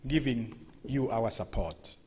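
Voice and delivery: ordinary talk, nothing marked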